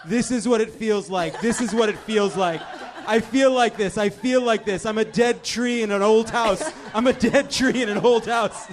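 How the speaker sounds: loud, laughing mock anguish; half-hearted haunted wail of despair; mock scream. Host voicing the decorators' inner selves, mock screaming.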